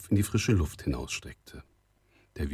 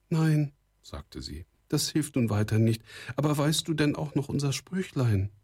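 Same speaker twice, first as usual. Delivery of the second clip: higher, lightly voiced girl; young girl; 8 semitones higher than usual. A narrator's reading. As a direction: echo him in a raised girlish register. Girl voiced, echoing the young man.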